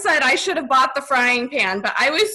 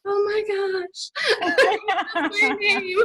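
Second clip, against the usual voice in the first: giggling high through the words; flustered giggly gush; laughing; 8 semitones higher than usual. Retold or imitated. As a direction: squeal it out between laughs. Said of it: "And I was like".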